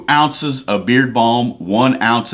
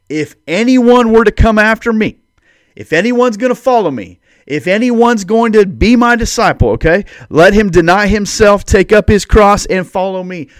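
higher and louder, driving each clause home; full-force preaching; 9 semitones higher, 6 dB louder than usual. Acted. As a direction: preach the call like a challenge. Preaching Jesus' call at full force.

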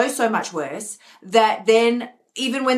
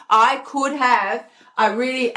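ordinary talk, nothing marked

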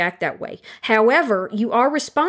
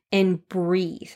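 soft breathe; 3 semitones lower, 4 dB quieter than usual. Shown as a demonstration. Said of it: "you need to stop".

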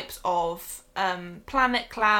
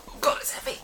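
whispering; faster than usual. Real, not acted. Lifting a heavy game box.

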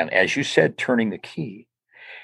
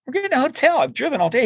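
tired driver; 13 semitones higher, faster than usual. Retold or imitated. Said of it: "I go".

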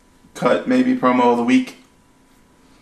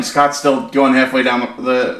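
ordinary delivery

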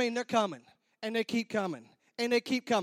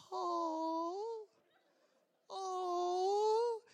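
long high "aww"; imitated crowd coo; 7 semitones higher, 4 dB quieter than usual. Wedding guests watching little flower girls come in, high cooing. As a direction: coo adoringly, drawn out and rising.

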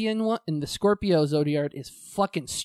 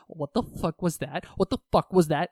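normal talking voice

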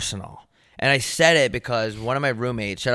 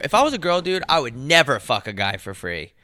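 ordinary delivery

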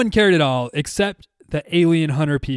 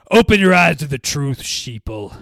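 paranoid late night rant; 5 dB louder than usual. Pretend. Mocking conspiracy theorists.

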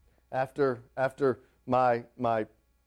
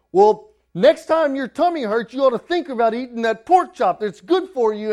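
higher, louder exasperated scolding; mad dad; 10 semitones higher, 8 dB louder than usual. Retold or imitated. Father tempted to scold his hungry son, angrily. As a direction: let the frustration boil over. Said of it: "I just want to get so mad".

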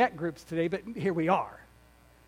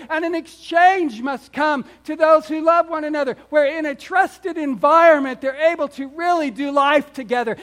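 louder, higher, emphatic preacher delivery; impassioned preaching; 6 semitones higher, 6 dB louder than usual. Real, not acted. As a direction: preach it forcefully, stretching key words. Preaches at full force.

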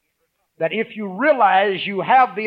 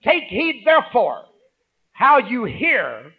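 slow, raised scripture reading; 7 semitones higher, 6 dB louder, slower than usual. Acted. Pastor reading verse eighteen to the church, slow and raised. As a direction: read the verse out with weight. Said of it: "Verse eighteen".

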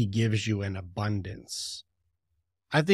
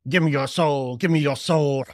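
loud, hammy devil demanding a soul, capped with an evil laugh; put-on pantomime villain voice; cartoon devil; 2 semitones higher, 6 dB louder than usual. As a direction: overblown devil with a booming cackle. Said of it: "devil is showing up ... his pitchfork going".

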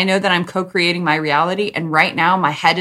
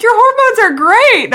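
much higher and louder, gushing; gushing friend; 8 dB louder than usual. Retold or imitated. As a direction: gush like an excited friend. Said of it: "She's like".

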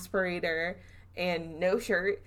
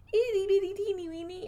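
much higher, tiny cutesy voice; cutesy squeak; 13 semitones higher than usual. Acted. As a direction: squeak it like describing something adorable. Cute tiny description.

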